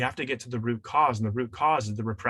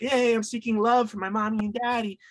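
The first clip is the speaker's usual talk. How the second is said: put-on little child; 10 semitones higher than usual. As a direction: play the kid cheerfully asking mommy and daddy for love. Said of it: "you're a little boy, you're a little girl ... and you're like".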